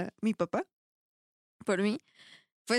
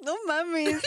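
laughing disbelief; 6 semitones higher than usual. Retold or imitated. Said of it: "yo así de".

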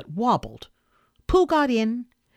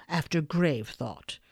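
plain reading voice

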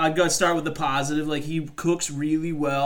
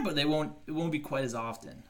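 normal talking voice

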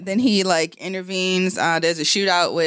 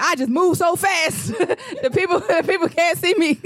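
a hint of Pops' boast; imitating Pops; 9 semitones higher than usual. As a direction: brag like Pops with the cape on. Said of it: "He's like".

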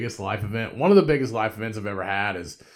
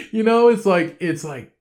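much higher, louder burst; exasperated outburst; 10 semitones higher, 11 dB louder than usual. Real, not acted. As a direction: blurt it out. Laughing exasperated peak.

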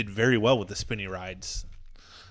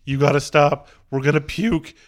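loud mock yell; raised-voice plea, reenacted; retold screaming; 3 semitones higher, 9 dB louder than usual. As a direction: call out urgently to stop the ride. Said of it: "me and him are both screaming".

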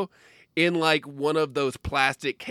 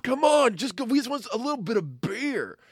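put-on whiny teen; 8 semitones higher than usual. Teen begging mom for beer.

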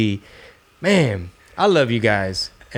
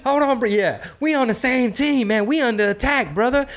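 much higher, nervous pleading voice; hammed-up pleading; 12 semitones higher than usual. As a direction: beg the intruder to hold on. Acting out talking to intruder.

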